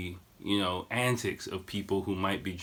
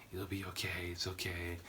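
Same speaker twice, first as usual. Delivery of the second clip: soft, hushed reassurance; soothing murmur; 3 semitones lower, 12 dB quieter than usual. Retold or imitated. Boyfriend rubbing her back, soothing murmur. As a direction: imitate someone gently comforting, very quietly.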